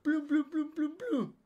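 high-pitched volley of "plum" bangs; rapid, high cartoon gunfire; imitated gunshots. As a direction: fire off mock gunshots with the mouth. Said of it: "como que tiraron siete tiros al aire".

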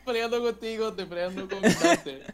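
laughing while talking, loud and high. Real, not acted. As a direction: laugh through the words, teasing. Laughing, teasing a co-host.

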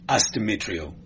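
usual speaking voice

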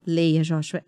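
low retold paternal command; firm father. Father telling crying son to read, firm. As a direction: order it firmly, pitched well down.

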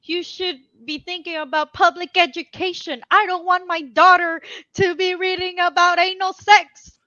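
mock screaming. Imitating an angry lady protesting school books, mock screaming. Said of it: "the lady was screaming like".

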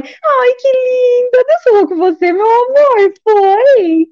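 put-on high, sugary voice for a small child; doting mother voice. Mother answering her little daughter, high sweet coo.

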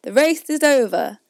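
loud shout to the runners; calling out. A narrator's reading. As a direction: announce the end with a shout. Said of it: "suddenly called out".

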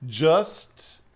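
low, deliberate persuasion with long pauses; coaxing friend. Retold or imitated. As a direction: imitate a buddy calmly egging you on. Voices friend coaxing him.

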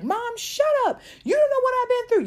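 shrieked high and loud at mom; very high, very loud hammed-up sass; mock teen outburst. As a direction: scream back like a furious teenager. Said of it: "I'm not saying it's time to be like".

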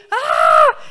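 low loud mock yell of pain; husband's groan acted out; imitated deep groan. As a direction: growl it out like a man hurting.

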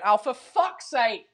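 slow, drawn-out mock exasperation; hammed-up frustration for a jingle; exasperated voiceover. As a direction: groan it out like a staged fed-up outburst. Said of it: "I'll record my voiceover just going".

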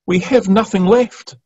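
high pleading rise on "have"; broken voice. Acted. People begging the Saviour for help, high and pleading. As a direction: beg with nothing left. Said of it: "You can hear broken voices saying those words".

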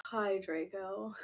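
slow, drawn-out gloomy greeting; depressed. Shown as a demonstration. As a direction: drag it out as if deeply depressed. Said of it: "she probably meant, like".

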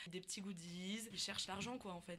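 soft, trailing, unconvinced; no conviction. Real, not acted. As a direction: mumble it without conviction. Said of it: "Aucune conviction dans ce que tu dis".

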